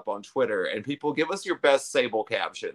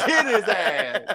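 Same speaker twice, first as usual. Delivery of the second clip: high, drawn-out echo through laughter; mock yelling; 8 semitones higher, 3 dB louder than usual. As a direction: copy the yell while cracking up. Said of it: "Shaniqua's still yelling".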